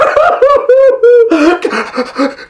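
high hammed-up wail falling away; long theatrical boo-hooing; exaggerated high sobbing; weeping sobs. A narrator's reading. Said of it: "weeping willow".

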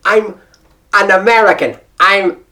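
put-on effortful, deliberate American accent; emphatic, carefully American-accented repeat; concentrated American accent. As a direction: correct yourself into firm American vowels. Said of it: "had to concentrate to get that good American accent back".

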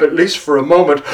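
usual speaking voice

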